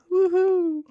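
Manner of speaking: very high yelping hoo hoo; high squeaky hoot. Retold or imitated. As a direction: squeak out a hoot as your stomach gets poked. Poked in stomach each time.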